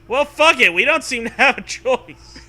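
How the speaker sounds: loud, laughing give-up. Real, not acted. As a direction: laugh it off loudly.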